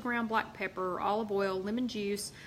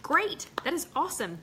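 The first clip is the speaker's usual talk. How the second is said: excited; 8 semitones higher than usual. Real, not acted. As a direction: cheer her on. Cheers a friend's race.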